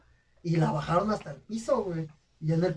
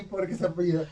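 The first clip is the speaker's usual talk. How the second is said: normal talking voice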